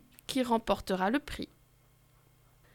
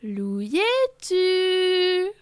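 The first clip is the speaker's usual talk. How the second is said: very slow, higher little calling voice; small voice; 6 semitones higher, much slower than usual. Acted. A small voice interrupting the ceremony, slow and high. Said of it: "une petite voix interrompt".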